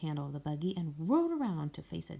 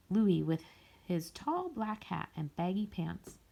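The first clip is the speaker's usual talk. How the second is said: plain reading voice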